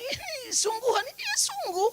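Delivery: lightly voiced surprised mother; high mock quote. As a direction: squeak out the reply. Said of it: "dia bilang".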